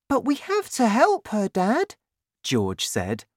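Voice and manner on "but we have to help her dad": put-on high-pitched pleading child; earnest high boy; young boy's voice. A narrator's reading.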